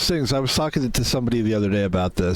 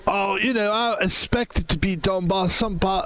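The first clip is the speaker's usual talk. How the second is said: higher mock British accent; imitating an English person; 6 semitones higher than usual. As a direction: lampoon a fussy Englishman. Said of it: "Even the dumbest person in England is like".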